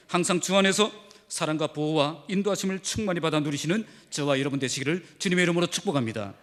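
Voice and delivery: ordinary delivery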